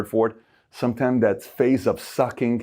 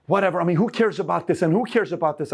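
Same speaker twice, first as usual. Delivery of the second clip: dismissive; 8 semitones higher than usual. Retold or imitated. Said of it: "some people are like".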